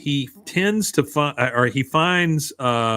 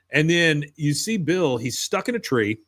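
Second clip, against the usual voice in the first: ordinary delivery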